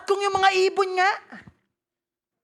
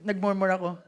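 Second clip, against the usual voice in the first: normal talking voice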